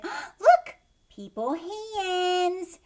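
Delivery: very loud high delighted cry, slow; delighted mother. Acted. Mother discovering her baby's hands, loud high delight. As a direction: gasp with joy at the baby. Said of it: "and said".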